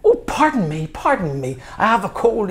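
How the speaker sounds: high, flustered mock apology; flustered lecturer. A hint of the English lecturer caught out by the student, high and flustered. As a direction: play the caught-out lecturer fumbling. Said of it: "He starts stuttering".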